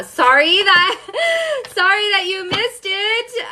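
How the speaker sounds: high, drawn-out sorry breaking into a laugh; teasing singsong sorry with laughter; playful laughing apology. Real, not acted. Playful apology to late viewers.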